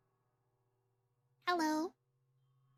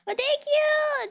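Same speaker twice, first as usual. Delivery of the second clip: excited thanks; 11 semitones higher, 4 dB louder, slower than usual. Real, not acted. Thanking raiders, surprised.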